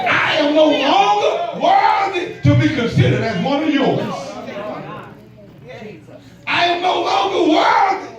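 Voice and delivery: crying out, pitch climbing; anguished cry. Acted. Prodigal son crying out to his father, anguished. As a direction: wail it out, broken and pleading.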